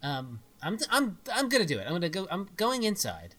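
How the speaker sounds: ordinary talk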